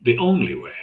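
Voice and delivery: "only" punched high; emphatic repeat. Shown as a demonstration. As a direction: hit "only" hard and high to drive it home. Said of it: "Did you hear that?".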